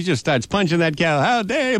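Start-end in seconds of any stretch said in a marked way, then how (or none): 1.2-1.8 mock outraged boy. Boy punches the cow.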